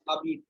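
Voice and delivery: habite said "a-beet", no H; silent H and Z. Shown as a demonstration. Teacher shows the silent letters, French pronunciation. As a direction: drop the H of "habite", starting on the vowel. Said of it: "We pronounce it as".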